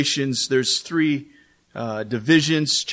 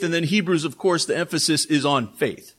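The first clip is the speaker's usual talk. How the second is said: ordinary talk, nothing marked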